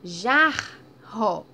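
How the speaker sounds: "Jarro" loud, open a then strong rr. Shown as a demonstration. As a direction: stress the rr in "Jarro". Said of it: "Teve esse som aberto e depois o som mais forte". ("Jarro" alto, com rr forte)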